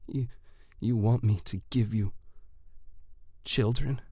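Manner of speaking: falter, then rise into a stunned question; halting, shaken female-character voice; quivering lips. A narrator's reading. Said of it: "Her lips quivered".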